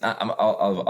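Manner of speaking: ordinary delivery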